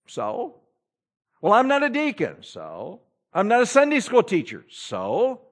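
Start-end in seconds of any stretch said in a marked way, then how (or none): 1.4-2.4 mock excuse. 3.3-4.6 mock excuse.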